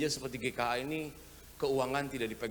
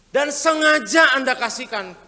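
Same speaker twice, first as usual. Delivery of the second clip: loud, slow, high emphatic build-up; hammed-up preacher punch; mock emphasis; 11 semitones higher, 17 dB louder, slower than usual. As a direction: lean hard into the irony. Mocking a showy giver.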